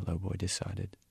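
plain reading voice

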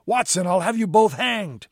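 high-pitched, heated protest; outraged calling. A narrator's reading. Mate in the water threatening Holmes and Watson, outraged calling. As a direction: shout threats up at the ship. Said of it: "he called".

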